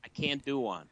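British speaker trying an American accent. Shown as a demonstration. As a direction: say "can't" with a flat American A. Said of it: "Oh, that was pretty good".

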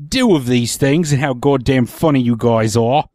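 put-on English accent, a little Michael Caine; mock English accent with stretched vowels. Shown as a demonstration. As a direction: read it in a hammed-up Cockney-ish English accent. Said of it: "Say that in an English accent".

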